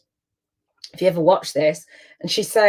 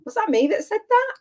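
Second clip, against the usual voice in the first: much higher, amazed question; amazed disbelief. Real, not acted. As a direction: gasp at your own clever line. Amazed by her own quote.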